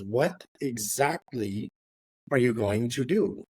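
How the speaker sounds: very slow, drawn-out demand; slow demanding question. Retold or imitated. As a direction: drag each word to pin him down. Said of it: "He goes".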